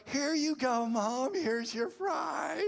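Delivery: very high, strained mock-kid voice, drawn out at the end; imitating begrudging kids. Kids grudgingly give mom a fry, high mock whine. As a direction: hand it over grudgingly in a squeaky put-on child voice. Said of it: "they're still mad about it".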